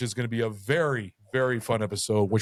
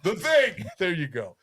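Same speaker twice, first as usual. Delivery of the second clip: high laughing voice; 7 semitones higher than usual. Real, not acted. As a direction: laugh through the words. Laughing at co-host's joke.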